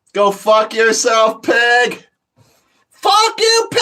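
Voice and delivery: very high, loud defiant scream; screaming at the cops. Retold or imitated. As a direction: scream it through the cell door. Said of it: "I'd start screaming so loud".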